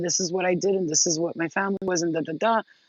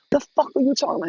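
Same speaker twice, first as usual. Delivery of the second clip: mock outburst, higher-pitched; triggered snap-back; 7 semitones higher, faster than usual. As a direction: act out flaring up at a partner. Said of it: "Why are you yelling at me".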